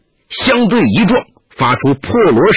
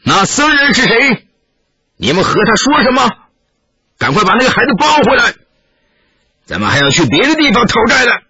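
higher, fast, commanding chieftain calling across; chieftain calling from afar; 7 semitones higher than usual. A narrator's reading. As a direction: bark orders from a distance. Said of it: "那酋长远远发话道".

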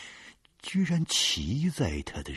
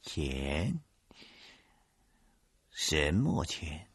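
puzzled; 3 dB quieter, much slower than usual. Acted. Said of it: "纳闷儿地问着我".

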